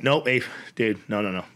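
low, soft, spent voice giving up; exhausted quitting. Retold or imitated. Beaten fighter tells his corner man he's done, low and drained. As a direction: beg to stop, barely any air left.

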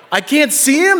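high, loud anxious protest; mock parental panic. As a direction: imitate a distraught dad's cry. Anxious father in story.

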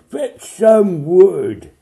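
high, very loud, very slow dragon voice; dragon Zog. A narrator's reading. Zog ordering wood for the fire, booming. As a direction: boom it out as the dragon. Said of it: "and Zog said".

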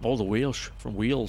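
put-on Welsh lilt; Welsh accent. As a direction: say it like someone from Wales. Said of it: "That's my Welsh accent".

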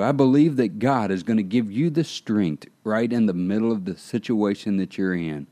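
ordinary talk

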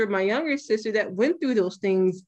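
usual speaking voice

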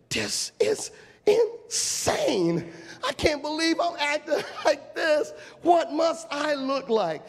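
high, slow, laughing at himself; laughing disbelief. Retold or imitated. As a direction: chuckle through the disbelief. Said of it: "and I said".

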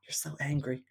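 soft whispered taunt; mock whisper. Quoting Denise's clip to her cohost, whispered mocking taunt. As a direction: breathe it out teasingly. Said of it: "she's like".

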